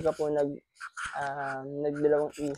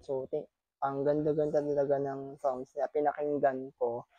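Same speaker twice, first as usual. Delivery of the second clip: ordinary talk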